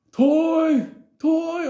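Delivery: high, loud drawn-out bellow; high hollered demand, stretched out; imitated screaming. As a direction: mimic someone screaming for a toy. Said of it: "this motherfucker screaming".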